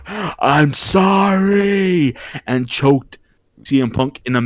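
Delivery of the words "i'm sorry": high, long-drawn anguished yell; imitated yell. John Cena yelling while choking his opponent, long high imitated yell. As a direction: imitate a wrestler wailing an apology, stretched right out. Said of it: "yelling in a way that I've never heard".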